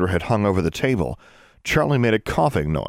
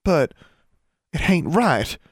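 higher, indignant Ozark twang; fuming Southern girl; 10 semitones higher, slower than usual. A narrator's reading. Constance fumes at the couple. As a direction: fume in a country accent. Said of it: "Constance fumed".

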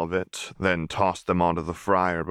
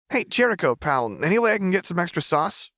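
put-on much higher, coaxing young boy voice; wheedling kid; 13 semitones higher than usual. A narrator's reading. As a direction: sweet-talk the cook like a cheeky kid. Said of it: "Milo wheedled".

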